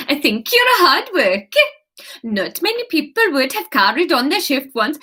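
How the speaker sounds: put-on nodding along in a Swedish accent. Anya praising Kate's hard work, in a nodding Swedish accent. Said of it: "I can only do it nodding".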